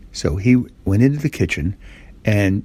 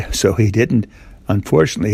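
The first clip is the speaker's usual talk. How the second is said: ordinary delivery, nothing marked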